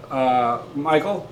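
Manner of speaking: ordinary talk, nothing marked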